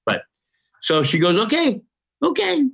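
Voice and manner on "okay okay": mock high woman's voice. Retelling her surprised reply.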